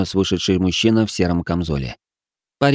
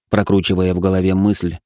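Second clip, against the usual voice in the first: usual speaking voice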